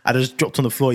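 ordinary talk

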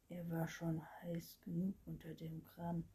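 ordinary delivery, nothing marked

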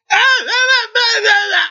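very high, slow wordless mute calls; mute son's cries. A narrator's reading. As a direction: imitate a mute man calling back in high, held cries. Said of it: "那意思，哎". (模仿哑巴高声咿呀应答)

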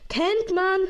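Kennt man shouted high and loud; loud exclamation. Real, not acted. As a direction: punch it out as a cheer. Repeats phrase, second louder.

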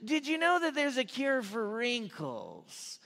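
slow, high weird-aunt voice; put-on voice of an odd aunt sharing a tip; Aunt Peggy. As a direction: draw every word out, pitched high. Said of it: "this is how she talked".

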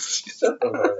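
hissed whisper. Real, not acted. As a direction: whisper it sharply. Unvoiced response.

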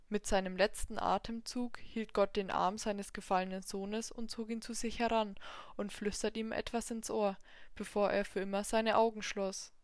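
ordinary delivery

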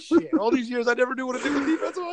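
lightly hammed-up Favre, shocked it's so simple; mock amazed Favre. Imitating Favre's amazed reaction.

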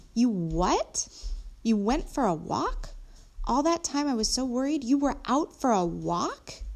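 can't believe what you just heard; indignant questions swooping up at each end; incredulous. A narrator's reading. Incredulous child reacts to confession.

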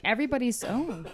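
imitating a chatty New Jersey aide; Jersey accent. Quoting grandma's aide gossiping in a Jersey accent. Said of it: "I'm putting my real Jersey accent on for her".

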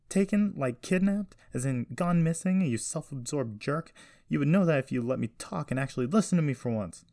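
high, loud, exasperated tirade; angry outburst. A narrator's reading. Jessie to her grandfather who won't listen, furious. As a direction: lash out, indignant and insistent.

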